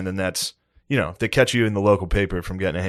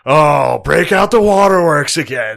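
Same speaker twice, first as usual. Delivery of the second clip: mock yell; 6 semitones higher, 7 dB louder, slower than usual. Mocking a crying girlfriend.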